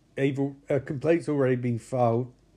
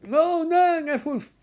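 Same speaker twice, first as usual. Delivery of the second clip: mock panic; 11 semitones higher, 4 dB louder than usual. Passengers panicking in crash.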